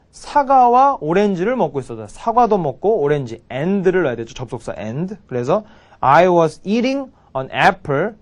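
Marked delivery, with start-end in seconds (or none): 6.0-8.1 very slow, word by word; drawn-out teaching demo; slow model sentence. Shown as a demonstration. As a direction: lay out each word slowly for the class. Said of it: "이렇게 됩니다".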